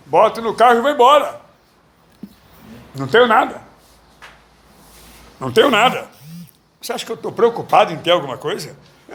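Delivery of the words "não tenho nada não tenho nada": ordinary talk, nothing marked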